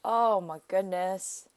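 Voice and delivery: usual speaking voice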